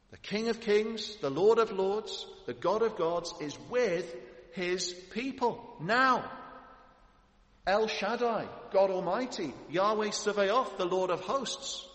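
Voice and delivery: preaching at full force. Acted. Preacher exalting God's names before the congregation, soaring and loud. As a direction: lift each divine title like a herald's cry.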